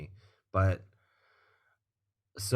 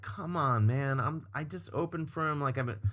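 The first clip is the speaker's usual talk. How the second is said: high, softened mock pleading; 6 semitones higher, 6 dB quieter than usual. Opener begging security guard to meet the star, pleading. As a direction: plead your case in a small, high voice.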